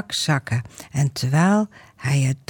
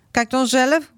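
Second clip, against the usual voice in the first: calling out; 9 semitones higher than usual. Acted. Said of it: "roept Joran".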